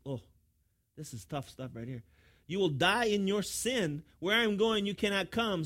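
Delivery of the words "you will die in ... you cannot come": raised, louder preacher's voice; forceful scripture reading. Acted. Preacher reads Jesus' warning to the congregation, forceful declamation.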